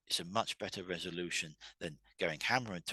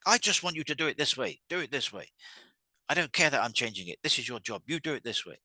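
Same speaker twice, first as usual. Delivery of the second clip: louder, higher, insistent retort; forceful orders in a raised voice; angry boss; 5 semitones higher, 6 dB louder than usual. Retold or imitated. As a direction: play a boss snapping back at staff. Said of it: "The boss is gonna probably react back".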